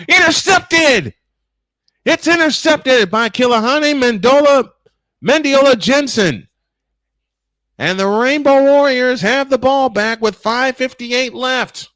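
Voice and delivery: high, loud burst of real excitement; shouted interception call. Real, not acted. Announcer shouts that Hawaii has intercepted, thrilled. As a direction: erupt at the turnover, voice leaping up.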